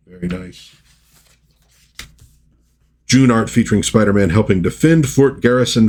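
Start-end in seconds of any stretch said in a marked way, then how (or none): 0.1-0.7 whispered aside. Real, not acted. Soft aside after caption.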